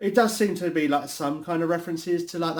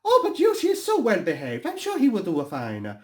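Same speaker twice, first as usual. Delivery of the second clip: high cartoon voice with a put-on accent, then laughing; bubbly, confident and high; cheery cartoon hero; 8 semitones higher than usual.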